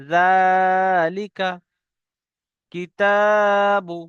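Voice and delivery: জালিকা and কিতাবু stretched, very slow; slow drawn recitation. Shown as a demonstration. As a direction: recite each word slowly with long vowels. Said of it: "যেমন".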